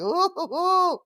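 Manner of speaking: high, hammed-up shivery "ooh"; a string of mock-spooked high oohs; creeped-out oohs. Host creeped out by clowns, mock-spooked oohs. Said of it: "I get a little creeped out".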